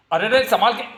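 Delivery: high, startled cry of caution; alarmed warning. Acted. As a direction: call out a sudden warning. Startled warning as someone is hurt.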